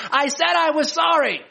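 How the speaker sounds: put-on a kid insisting they already apologized; pleading child. Child chasing sibling to parents, high whiny protest. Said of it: "the other child is saying".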